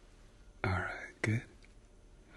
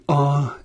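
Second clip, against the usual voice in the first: saying ahh; 5 dB louder than usual. Shown as a demonstration. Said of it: "if you can say".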